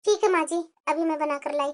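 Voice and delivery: daughter-in-law quietly obeying her scolding mother-in-law, softly; meek daughter-in-law. Acted. Meek bahu answers mother-in-law.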